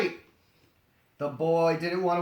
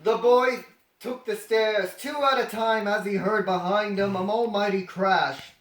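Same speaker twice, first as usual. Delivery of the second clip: plain reading voice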